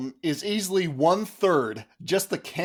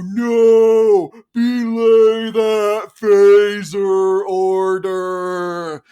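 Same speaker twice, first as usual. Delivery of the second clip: very slow, loud, drawn-out yell; mock slow-mo shout; slow-motion warp voice; 4 semitones higher, 10 dB louder, much slower than usual. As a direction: stretch every word like a warped slow recording. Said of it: "we hear everyone go".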